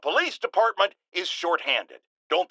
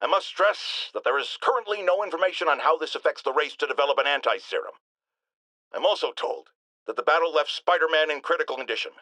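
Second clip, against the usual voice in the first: ordinary talk, nothing marked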